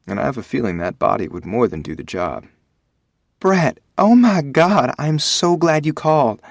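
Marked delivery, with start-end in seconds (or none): 3.4-6.4 high, loud southern gush, slow at first; gushing with relief in a southern accent; sweet southern accent. Acted. Emma greeting Brett on arrival, high loud southern gush. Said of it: "Her sweet southern accent".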